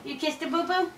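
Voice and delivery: usual speaking voice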